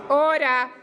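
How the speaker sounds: loud call to order over the noise; shouting order. Real, not acted. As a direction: bark out order to silence the room. President shouting over noisy chamber.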